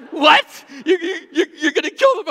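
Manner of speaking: high, loud mock shock, stuttering; shocked outburst. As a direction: blurt it out in disbelief. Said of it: "I'm like".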